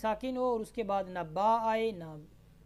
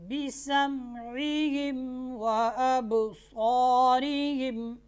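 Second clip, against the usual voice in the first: slow chanted tajweed recitation; Quran recitation; 5 semitones higher, 8 dB louder, much slower than usual. Acted. Teacher recites the verse for students, slow and chanted. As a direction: intone the verse slowly, melodically.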